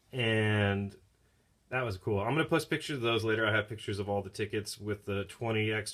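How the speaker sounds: ordinary talk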